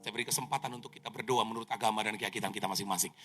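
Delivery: calm the passengers like crew on the intercom; flight attendant announcement. Retold or imitated. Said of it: "Pramugari berkata".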